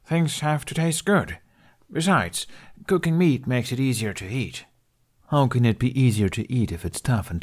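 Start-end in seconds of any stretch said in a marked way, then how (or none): 0.0-4.7 put-on lightly raised teen boy voice, arguing; a young lad insisting on his point; Hiccup voice. Hiccup arguing about cooked meat.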